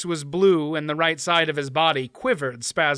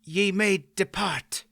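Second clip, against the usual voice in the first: painfully; 4 semitones higher, slower than usual. A narrator's reading. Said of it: "replied painfully".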